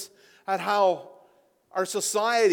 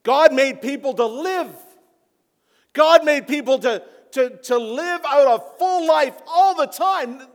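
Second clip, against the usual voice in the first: much higher and louder, building to a shout; impassioned cry; 11 semitones higher, 12 dB louder than usual. Retold or imitated. Jesus at the graveside protesting death, crying out loud. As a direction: cry out against death itself. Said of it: "and he's going".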